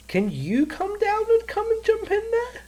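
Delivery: high, slow mocking lady voice; man doing a woman's voice. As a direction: ask in a drawn-out falsetto. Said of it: "The vo-, lady voice on the radio".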